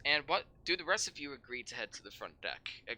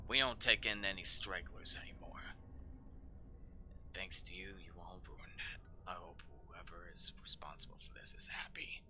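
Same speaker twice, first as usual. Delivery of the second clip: hissed resentment; bitter whisper; 7 semitones lower, 6 dB quieter than usual. Acted. Sergeant muttering blame at the newcomers, whispered.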